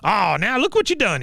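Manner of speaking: high, scolding old-veteran impression; cranky mock reproach; Cotton. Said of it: "He goes".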